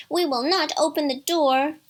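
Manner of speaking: high, loud little goats; bleated refusal. A narrator's reading. Little goats refuse the wolf, lightly bleating. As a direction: lightly bleat it as defiant kids. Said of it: "they bleated".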